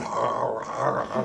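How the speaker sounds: low, groaning monster noise; man with fly head. Acted. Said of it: "and he goes".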